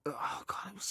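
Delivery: breathy whispered oh God; hushed, stunned whisper; whispered dread. Retold or imitated. Recalling dread at huge ratings.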